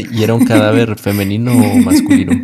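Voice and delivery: asked through laughter; laughing. Real, not acted. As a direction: laugh while you ask it. Listener laughing while asking.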